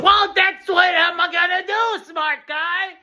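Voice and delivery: high, slow, loud retort; defiant shout. Acted. Patient retorting to the doctor, high defiant shout. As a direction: fling it back at him.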